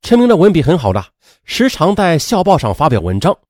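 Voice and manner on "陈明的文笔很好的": usual speaking voice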